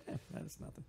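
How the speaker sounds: whispered, shrugging eh that's nothing; dismissive. Retold or imitated. Imagined reader shrugging off a journal, dismissive whisper. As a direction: brush it off under the breath. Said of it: "just be like".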